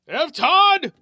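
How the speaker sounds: high, loud excited cry; gasped call. Acted. Old fisherman calling to F, loud and excited. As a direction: blurt it out, hooked and thrilled. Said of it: "gasped Fisherman Jones".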